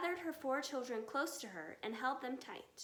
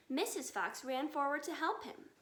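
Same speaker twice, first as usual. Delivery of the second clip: ordinary delivery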